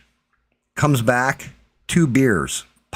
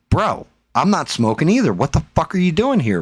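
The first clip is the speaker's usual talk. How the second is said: raised-pitch, incredulous refusal; retold indignation; 6 semitones higher than usual. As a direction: push back, appalled at the offer. Retold objection to offered hit.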